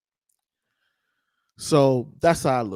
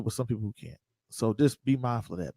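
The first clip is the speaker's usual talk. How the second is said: ordinary talk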